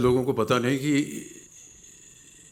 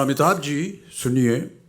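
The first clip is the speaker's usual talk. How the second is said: quoted request, pitched higher; higher, drawn-out retold call; calling someone back; 5 semitones higher, 5 dB louder than usual. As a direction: stop someone leaving, lightly acted. Retells singer calling him back.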